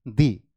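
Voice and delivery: "दी" low, with an ee vowel; "दी" dropped low as the answer; article said "dee". Shown as a demonstration. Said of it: "उसकी pronunciation क्या हो जाएगी".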